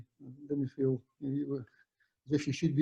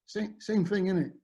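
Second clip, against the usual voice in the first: rough Cockney imitation, pitched up; Frank Lampard; 6 semitones higher than usual. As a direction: toss it back in mock Cockney. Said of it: "I'm not good at Cockney accent".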